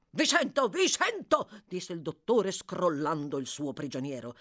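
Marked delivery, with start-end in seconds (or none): 0.1-1.5 high, agitated repeated retort; angry impatience. Acted. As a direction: snap back while shaking him. Doctor shakes his prisoner. (irritato e concitato)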